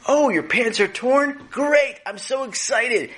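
very high, gushing mom voice; mock-excited mother. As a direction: gush with exaggerated delight, high-pitched. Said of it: "your mother said".

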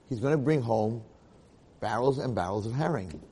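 ordinary delivery

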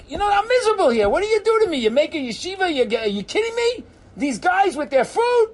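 high, loud, indignant rant; worked-up tirade of disbelief; exasperated outburst. Acted. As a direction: complain at a high pitch, at wit's end. Imagined outburst to board members.

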